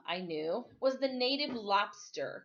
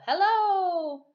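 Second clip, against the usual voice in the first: bright, higher called-out hello; cheerful hello; 10 semitones higher, 9 dB louder than usual. Acted. Boy hoping for a greeting from shore, cheery call. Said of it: "hoping there would be a cheerful".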